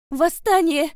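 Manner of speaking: high, loud outburst; careless shout. A narrator's reading. Vika shouting out about an uprising, careless shout. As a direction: blurt it out at the top of your voice. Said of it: "неосторожно выкрикнула Вика".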